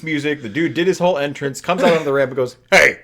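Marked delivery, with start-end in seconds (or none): 2.7-3.0 loud call-out then laughing; shouting. Acted. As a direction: yell it down the ramp. Wrestler calls out from ramp.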